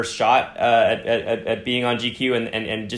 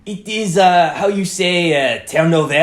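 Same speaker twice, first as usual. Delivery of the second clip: hammed-up French accent; 7 semitones higher, 3 dB louder than usual. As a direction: play a caricature French waiter groping for English. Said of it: "definitely was dialing it up to, like, eleven".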